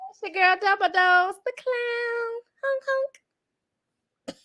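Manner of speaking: high silly clown voice. Acted. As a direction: play the goofy clown. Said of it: "Well, I am the clown".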